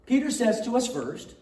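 ordinary talk

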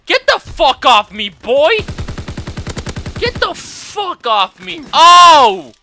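loud high cursing yell; swearing tirade. Real, not acted. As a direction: shout curses at the attacker.

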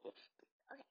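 slow unvoiced whisper to viewers. Real, not acted. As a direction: lean in and whisper.

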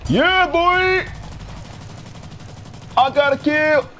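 high, triumphant whoop over the kill; excited cheer. Real, not acted. Gamer celebrating his first kill, high and triumphant.

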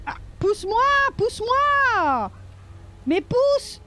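high pleading push me, rising and stretched; pleading whine. Real, not acted. Player begs Lydia to push her, high pleading whine. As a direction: whine to be pushed, drawn out.

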